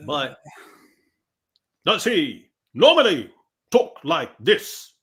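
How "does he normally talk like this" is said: slow, loud, word-by-word mock oratory; theatrical mimicry. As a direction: mimic an overdramatic speaker, stretching each word. Mimicking the speaker's theatrical delivery.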